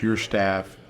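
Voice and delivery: usual speaking voice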